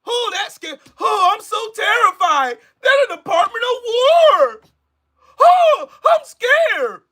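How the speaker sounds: high-pitched fake fright; mock terrified. Pretending the Department of War scares him, mock terror. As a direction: squeal in hammed-up, sarcastic fear. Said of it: "that's gonna scare 'em".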